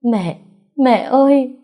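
high, loud wailing call to her mother; sobbing cry. Acted. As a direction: burst out crying like a child. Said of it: "khóc òa lên như một đứa trẻ".

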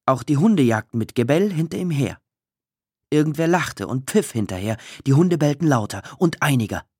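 usual speaking voice

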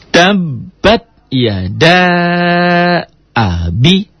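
"Tabbat yada abi" slow with long a; slow recitation. Shown as a demonstration. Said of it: "Kenapa panjang".

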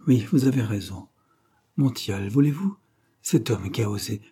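ordinary delivery, nothing marked

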